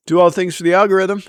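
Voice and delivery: quick, brighter catchphrase read; sign-off catchphrase. Acted. Vlogger signing off to viewers, a quick catchphrase. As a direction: toss off the slogan fast. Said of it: "sign-off motto".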